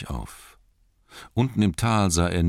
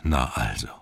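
slightly lowered, measured wizard voice; relieved old wizard. Acted. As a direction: greet a lost friend with understated relief. Relieved Gandalf greets Bilbo.

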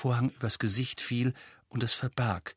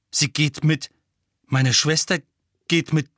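empört; 5 semitones higher, 7 dB louder than usual. A narrator's reading. Ron empört über Schwester.

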